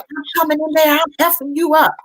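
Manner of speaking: high-pitched mock yell at the door; retold yell. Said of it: "And all this time he yelling".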